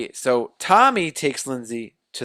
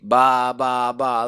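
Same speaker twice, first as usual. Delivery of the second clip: mock sheep bleat, slightly raised volume; imitated sheep chant; 5 dB louder, much slower than usual. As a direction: bleat like a mindless flock. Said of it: "if you ever heard sheep".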